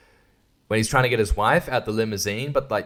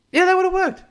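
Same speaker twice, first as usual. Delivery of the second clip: squeaky; 4 dB louder than usual. Real, not acted. Sudden high squeak.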